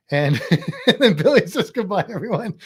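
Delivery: laughing through the words; real amused laughter in the voice. Real, not acted. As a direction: read it out while giggling. Laughing at viewer's timing.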